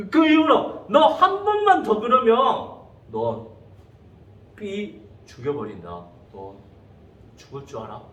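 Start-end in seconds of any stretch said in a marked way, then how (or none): 0.9-2.4 high, loud acted warning; retold angry dad's threat; threatening father. Father warns the bully. 3.1-8.0 low, hushed acted threat; retold dad's quiet death threat; menacing father.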